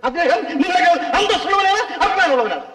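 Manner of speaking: high-pitched, outraged objection; indignant protest. Acted. Man rebuking questioner for disrespecting the gentleman, indignant. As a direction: scold from high up in the voice.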